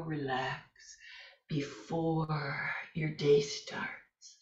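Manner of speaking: slow whisper; whispered cue. Shown as a demonstration. Yoga teacher guiding students to rest, whispering slowly. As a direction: let the words float out slowly in a whisper.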